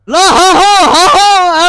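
booming slow laugh; loud laughing. Real, not acted. Big laugh in rain.

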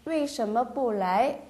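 each syllable spelled out slowly; slow model reading. Shown as a demonstration. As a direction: read it slowly for learners. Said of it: "이렇게도 얘기하죠". (천천히 또박또박 읽는 예문)

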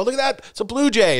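put-on high excited old-man birdwatcher; delighted birdwatcher. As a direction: gush like an old man spotting a bird. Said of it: "And then I go".

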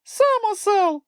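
very high, slow squeaky retort; snapping back. A narrator's reading. Chmykh returns Moomintroll's insult, squeaky snapping back. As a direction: throw the insult back in falsetto. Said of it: "відгаркнувся Чмих".

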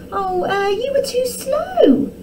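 high, drawn-out teasing; giggly taunt. A narrator's reading. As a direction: tease them in a high princess voice. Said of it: "giggled Arabella".

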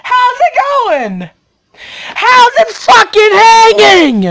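over-the-top shouted intro; yelled greeting. Acted. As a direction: belt out a hammed-up hello, stretching the last words. Hammed-up yelled greeting to viewers.

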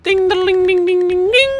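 high, loud "ding" jingle; ringing sound effect done by voice; imitated chime. Imitated chime when equipping godlies.

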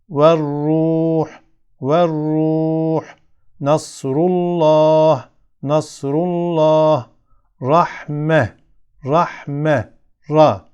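"Ver-Ruh, Nasrullah, Rahme" recited with a heavy, thick r. Shown as a demonstration. Teacher gives examples of ra, "Ver-Ruh, Nasrullah, Rahme" with thick r. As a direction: say each of "Ver-Ruh, Nasrullah, Rahme" twice, r full and dark, long vowels held. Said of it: "kalın okuyorum".

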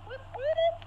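high, loud whoop whoop; playful whooping. Pretend. Gamer joking about the missing stream patroller, playful whooping.

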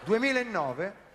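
usual speaking voice

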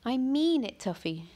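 put-on high and loud, firm young girl; firm girl's voice. A narrator's reading. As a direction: put your foot down like a upset girl. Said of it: "she told me".

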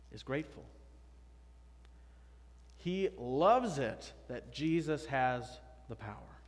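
ordinary talk, nothing marked

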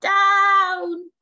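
high, loud, drawn-out falling shout; witch's cry. A narrator's reading. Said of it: "cried the witch".

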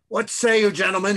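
loud, high call to a crowd; echoing yell. A narrator's reading. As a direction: yell it out across a hall. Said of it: "he yells, his voice echoing".

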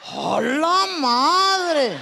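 slow, loud laughing cry; laughing shout. Real, not acted. Comic recalling men chatting at urinals, slow loud laughing shout. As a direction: stretch it out, laughing in disbelief. Shocked at men peeing together.

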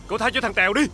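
high-pitched, urgent appeal; pleading. Acted. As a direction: appeal to the boss for your friend. Begs boss to spare friend. (khẩn khoản van xin, cao giọng)

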